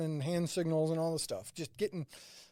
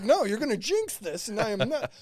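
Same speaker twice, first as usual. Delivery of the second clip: emphatic refusal; 6 semitones higher, 7 dB louder than usual. Retold or imitated. Refusing, jinx fear.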